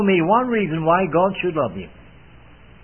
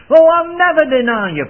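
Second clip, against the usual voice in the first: much higher, louder, self-assured protest; confident Peter; 11 semitones higher, 8 dB louder than usual. Acted. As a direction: Peter boasting he'll never fail. Peter's boastful reply voiced.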